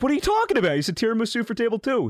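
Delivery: high-pitched, exasperated comeback; retold incredulous protest. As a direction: act out arguing back at the waiter. Retold argument with the waiter.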